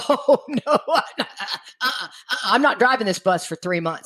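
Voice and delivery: laughing it off, no way; laughing refusal. Retold or imitated. Refusing to keep driving the bus, laughing. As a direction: chuckle through a flat refusal.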